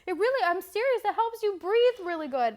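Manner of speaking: high, loud earnest sales pitch; imitating insistent date. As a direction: push the point excitedly.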